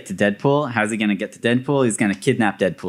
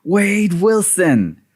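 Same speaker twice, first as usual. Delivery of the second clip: drawn-out taunting call, slow and higher; hammed-up villain call across the yard; slow, mock-theatrical shout; 7 semitones higher, 3 dB louder, much slower than usual. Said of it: "he shouts from the top of the ship".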